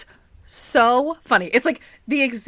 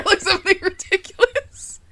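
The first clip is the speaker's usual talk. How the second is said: ordinary talk, nothing marked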